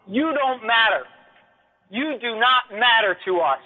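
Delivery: ordinary delivery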